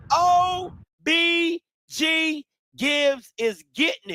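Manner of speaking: stretched very slow, high; slow teasing chant of the name; drawn-out taunt. Real, not acted. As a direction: drag each letter out mockingly.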